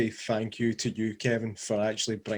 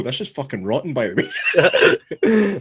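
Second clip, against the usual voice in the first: laughing; 2 semitones higher, 6 dB louder, slower than usual. Retold or imitated. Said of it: "you go".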